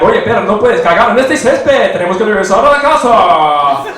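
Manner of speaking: loud hyped announcer voice telling off the dog; radio DJ voice. Retold or imitated. Announcer voice scolding his dog on walks. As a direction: boom it at the dog like a radio host. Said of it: "doing that voice to my dog".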